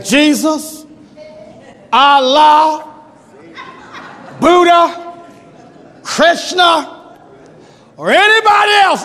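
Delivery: a panicked soldier praying to anyone; frantic foxhole prayer. Acted. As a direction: call out every god you can think of. Preacher acts frantic foxhole prayer.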